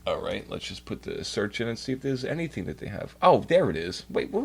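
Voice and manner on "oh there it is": ordinary talk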